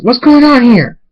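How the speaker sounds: high, loud demand; alarmed shout. A narrator's reading. Pinkie walking in on Thunderstorm, alarmed high shout. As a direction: burst in and demand an answer. Newcomer interrupts the torture.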